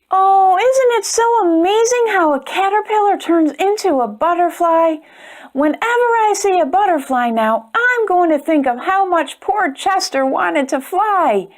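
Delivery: put-on bright, chatty aside to young listeners; storyteller's warm closing aside; reading to children. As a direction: lean in and share the wonder with the kids.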